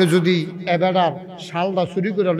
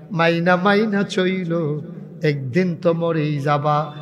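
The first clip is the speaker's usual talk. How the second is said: normal talking voice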